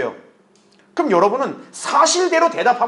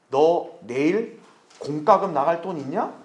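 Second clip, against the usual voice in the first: slow, measured questioning voice; the Lord asking; 3 semitones lower, slower than usual. Acted. The Lord asks about tomorrow's bills, slow probing voice. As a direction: space the question out slowly.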